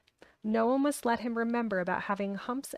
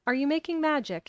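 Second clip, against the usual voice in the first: higher-pitched, sharply put question; 6 semitones higher, 4 dB louder than usual. A narrator's reading. Boy suddenly suspects magic and asks his friend sharply. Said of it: "he asked sharply".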